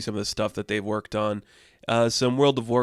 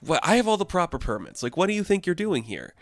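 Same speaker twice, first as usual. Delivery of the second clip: higher-pitched mock indignation; indignant protest; 8 semitones higher than usual. Food truck owner challenging the robots, high indignant protest. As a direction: protest in a raised pitch, acting affronted.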